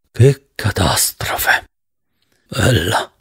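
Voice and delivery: a hushed, slow whisper of despair; dismayed whisper. A narrator's reading. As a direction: breathe it out in dismay. Narrator's dismayed inner exclamation.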